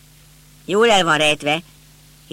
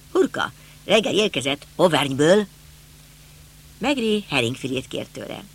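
plain reading voice

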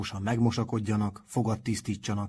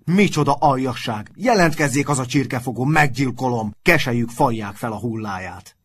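loud, raised-pitch furious rant; wild angry shouting; 4 semitones higher, 9 dB louder than usual. A narrator's reading. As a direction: rage at the unknown culprit. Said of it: "kiáltott vadul".

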